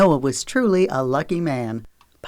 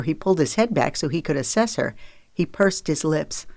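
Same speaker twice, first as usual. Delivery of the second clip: ordinary delivery, nothing marked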